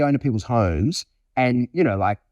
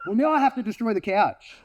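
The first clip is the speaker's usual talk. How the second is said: higher-pitched comic resignation; put-upon dog; 7 semitones higher than usual. Acted. Dog left wound up by a guest, higher put-upon voice. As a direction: voice a dog with no choice. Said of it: "And I was like".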